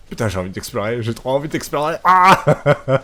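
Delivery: excited. Real, not acted. Eager to explore the game.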